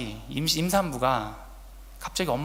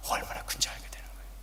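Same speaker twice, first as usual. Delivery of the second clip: sudden intimate whisper; 6 dB quieter than usual. Acted. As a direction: drop to a breathy secret for effect. Preacher drops to whisper.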